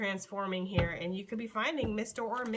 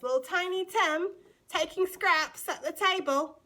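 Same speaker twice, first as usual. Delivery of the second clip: higher-pitched, slow mock-British voice; bad British accent; 7 semitones higher, 4 dB louder than usual. Tarot reader describing Tiny Tim at the table, bad British accent. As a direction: stretch it out in an amateur British accent. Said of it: "doing that British accent".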